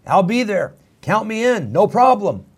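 mock enthusiastic volunteer; eager yes. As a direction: jump at the chance, all in. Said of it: "you'd reply right away".

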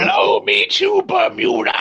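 high, slow wizard cry; Merlin voice. Retold or imitated. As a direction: shout it like the old wizard. Merlin's line quoted.

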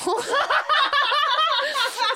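squealing laugh; helpless laughing fit; loud high laughter. Real, not acted. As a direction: crack up hard. Laughing after butthole joke.

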